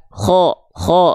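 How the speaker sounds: drawn-out "kho", leaning toward O; heavy "kho", held long; thick O-like vowel. Shown as a demonstration. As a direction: say "kho" thick, the fathah sounding like O. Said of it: "cenderung seperti vokal O".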